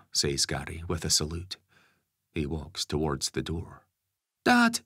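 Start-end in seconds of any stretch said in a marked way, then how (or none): 4.4-4.8 put-on high loud shout from a young boy. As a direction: call out after dad like a kid. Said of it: "Ben shouts".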